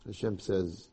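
ordinary talk, nothing marked

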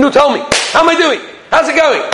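high-pitched, hopeful questioning; eager asking. Acted. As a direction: ask keenly for a progress report. Wife eagerly checks her progress.

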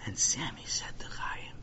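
suspenseful storyteller's whisper; soft, breath-only lead-in; hushed narration. A narrator's reading. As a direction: whisper it to set up a grave moment. Narrator hushes before grave accusation.

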